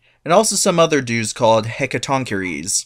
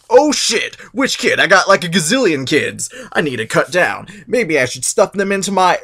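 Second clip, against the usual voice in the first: panicked Cronus; 6 semitones higher than usual. Retold or imitated. Cronus panicking over the oracle, alarmed. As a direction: fret about the prophecy. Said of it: "And he's like".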